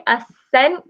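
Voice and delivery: "ascent" as uh-SENT, rising at the end; just an S in "ascent", second syllable stressed; ascent, no K sound. Shown as a demonstration. Classmate pronouncing the spelled-out ascent, no K sound. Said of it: "With, with A S".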